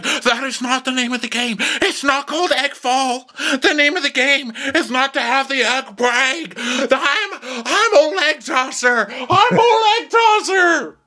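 high mock sobbing protest; crying little-boy tantrum. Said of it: "literally crying like a little boy".

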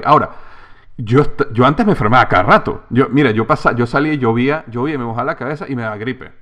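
ordinary talk

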